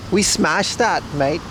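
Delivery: put-on Aussie accent; Australian accent. Streamer imitates Australian girl from previous job, Australian accent. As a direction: talk like an Australian mate. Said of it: "She always talked like that".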